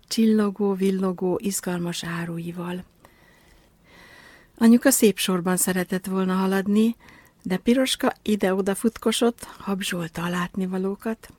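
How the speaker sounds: plain reading voice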